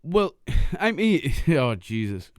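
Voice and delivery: high-pitched, breaking into laughter between words; real laughing. Real, not acted. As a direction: try to respond while cracking up. Cracking up at the bee story.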